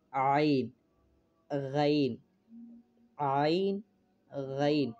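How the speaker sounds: throat letters আইন গাইন. Shown as a demonstration. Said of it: "আমাদের গলার মধ্যে থেকে আসে".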